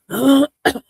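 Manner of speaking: sudden harsh cough; a real cough bursting out; coughing fit. Real, not acted. Choked on pizza earlier.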